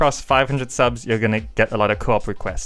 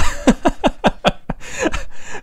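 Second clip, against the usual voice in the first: high, breathy burst of laughter; real laugh. Real, not acted. Vlogger asked for an accent breaks up, laughing. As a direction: crack up in a long chuckle.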